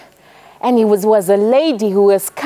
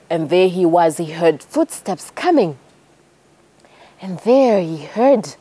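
ordinary talk

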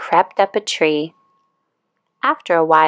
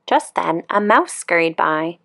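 ordinary delivery, nothing marked

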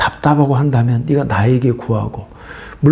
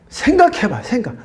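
sudden much higher cry of 생각해봐; exclaiming; 10 semitones higher than usual. Acted. Preacher exhorting congregation to think, high exclamation. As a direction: fling it up high, then drop on 생각.

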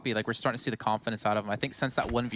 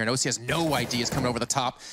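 ordinary talk, nothing marked

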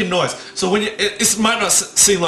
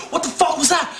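startled; 11 semitones higher than usual. Retold or imitated. Startled by own reflection.

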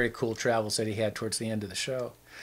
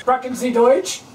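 calling out loud; 12 semitones higher, 11 dB louder than usual. Pretend. Knocking on doors calling out.

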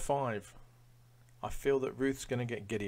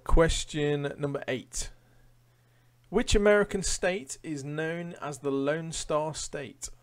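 slow, louder quizmaster read-out; quiz question read; 5 semitones higher, 8 dB louder, slower than usual. Acted. Quizmaster reading a question to online quiz players, announcer style.

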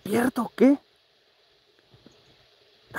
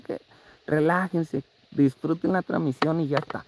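usual speaking voice

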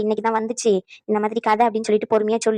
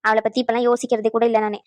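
ordinary delivery

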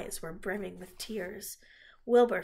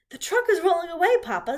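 high, loud call of alarm; urgent child's shout, pitched way up; alarmed girl; 10 semitones higher, 11 dB louder than usual. A narrator's reading. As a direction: call out a warning to Papa. Fern warns her father, redone in character.

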